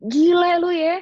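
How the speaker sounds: high, loud exasperated retort; high mock outrage, as if someone squeezed lime on a wound; inner outburst. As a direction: snap at him in your head. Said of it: "dalam hati gue".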